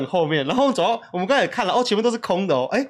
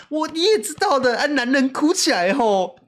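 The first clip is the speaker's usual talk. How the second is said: high, louder exasperated excuse; helpless wife; 10 semitones higher, 3 dB louder than usual. Pretend. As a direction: play the wife who gave up. 扮演无奈的老婆. (扮演无奈的老婆，高声诉苦)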